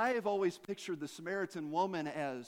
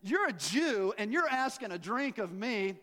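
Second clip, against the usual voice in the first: sassy, challenging questions; a bit of attitude; 4 semitones higher than usual. Acted. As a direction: imitate a woman giving some lip. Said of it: "saying this with a little bit of attitude".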